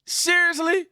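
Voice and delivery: very high, loud outraged squeal; hammed-up disbelief in a shrill voice; mock outraged shriek. Continuing mock hysteria.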